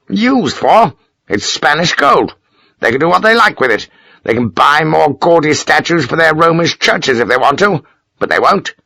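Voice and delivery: mock the Spanish churches; scornful Major. Major sneering to Sharp about Spanish gold.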